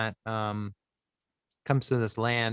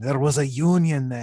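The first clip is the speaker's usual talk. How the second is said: put-on loud hammy Russian accent; a booming mock Soviet patriot; 2 semitones higher, 7 dB louder than usual. Mock nostalgia for Soviet Union.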